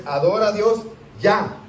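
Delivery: stern order. Shown as a demonstration. Angel commanding apostle John to worship God, firm order. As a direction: bark it as an order, no softness. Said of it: "No, le dio una orden".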